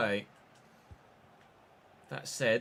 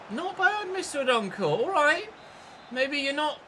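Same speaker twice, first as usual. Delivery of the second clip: high-pitched, surprised approval; high squeaky amazement at a player; surprised praise; 13 semitones higher, 4 dB louder than usual. Real, not acted. Mock praise of a poor player.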